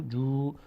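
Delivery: جو with a drawn u; long u sound. Shown as a demonstration. Teacher explains the damma, long u sound. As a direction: round جو into a held "oo". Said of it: "ضمه بعدش با صدای او".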